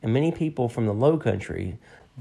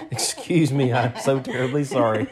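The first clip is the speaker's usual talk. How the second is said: laughing mock apology; 3 dB louder than usual.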